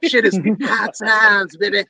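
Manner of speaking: slow drawling wrestling promo voice; slow, wrestler-style hype promo; Dusty Rhodes promo. Retold or imitated. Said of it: "like a Dusty Rhodes promo".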